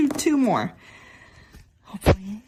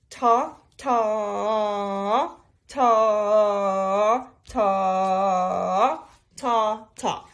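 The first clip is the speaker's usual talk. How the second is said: evenly spaced spoken "ta" beats; steady spoken "ta" counting; rhythm syllables; much slower than usual. Shown as a demonstration. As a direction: clap out the rhythm with "ta, ta, ta". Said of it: "let's do our rhythm".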